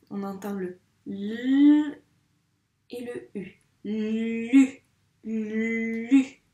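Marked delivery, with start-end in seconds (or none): 0.5-3.6 teacher isolating "l" and "u"; sounds isolated. Shown as a demonstration. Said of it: "Quels sont les deux sons". 3.8-6.4 "Lu" with a long l rising into a loud u; emphatic drawn-out "Lu"; sounds blended slowly. Shown as a demonstration. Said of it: "Quels sont les deux sons".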